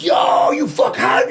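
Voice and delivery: slow, high hyped yell; mock hype yell; hyped shout. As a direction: shout it like backstage fans. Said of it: "everyone's like".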